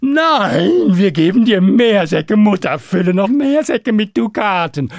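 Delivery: high, loud panicked cry of dragons; panicked shout. Acted. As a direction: scream no in sheer alarm. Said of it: "Da schrien Drache und Drachenmutter auf".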